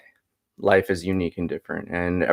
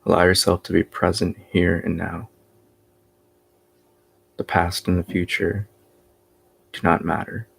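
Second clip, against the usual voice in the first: guided meditation; 2 semitones higher than usual. Real, not acted. Teacher guiding listeners after three oms, guided meditation voice. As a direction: lead them into stillness, one unhurried phrase at a time.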